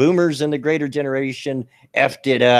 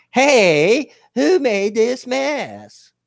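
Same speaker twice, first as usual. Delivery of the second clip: high, slow, put-on kiddie-show voice; children's show character; 12 semitones higher, 4 dB louder, much slower than usual. Kids' show character finding a mess, exaggerated high voice. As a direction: stretch it out in a high cartoon sing-song.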